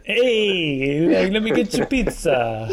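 put-on jokey pizza-guy Italian accent; playful mock Italian accent. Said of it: "Every accent you do is Italian".

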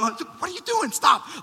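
high-pitched, hushed protest at yourself; mock self-scolding. Telling himself off for typing Facebook, high mock scolding. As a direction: squeak it out in mock alarm.

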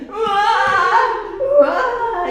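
high sung wail; mock crying. A narrator's reading. Little girl wailing in the tree, mock crying. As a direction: wail like a small child. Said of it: "E a menina?".

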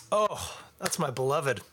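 ordinary delivery

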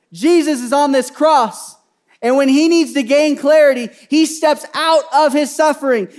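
loud, high, impassioned sermon; fervent preacher building to a cry; full-force preaching. Real, not acted. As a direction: drive it home to the congregation.

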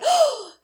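high sharp gasp of shock. Retold or imitated. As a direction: gasp like a scandalised old lady. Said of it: "completely shocked".

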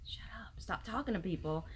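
whispered private rebuke; hushed scolding. Retold or imitated. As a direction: whisper it as if to yourself, wishing he would stop. What she privately thinks at husband.

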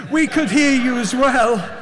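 loud, high attempt at an American accent. Another diner calling across restaurant, American accent. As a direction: call it over in a put-on American voice. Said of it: "The American accent's gone".

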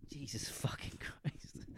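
strained, breathy whisper while pushing. Real, not acted. Straining mid-fart into the mic, whispered. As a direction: squeeze it out under your breath.

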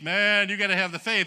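mocking hyped-up preacher; mildly mocking revival zeal; mock hype. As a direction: lampoon the hype-faith crowd. Mocking hyped-up faith talk.

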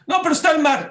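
loud, high-pitched protest; protesting objection. Retold or imitated. People told to advance into the sea, protesting loudly. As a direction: object urgently, pitch jumping up. Acted objection in retold argument.

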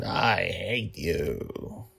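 slow, soft imitation of a child; quoting a child. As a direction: echo the girl's words gently. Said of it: "the first time she said".

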